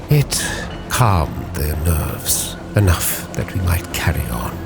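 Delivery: usual speaking voice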